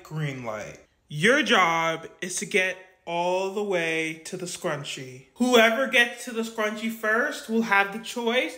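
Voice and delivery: game show host. Acted. Doll plays the challenge host.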